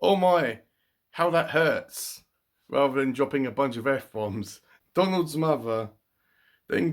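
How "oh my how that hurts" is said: much higher, slow "how that hurts", poking fun; mock prim exclamation. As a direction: parody an overly polite ouch.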